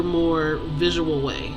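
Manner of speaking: usual speaking voice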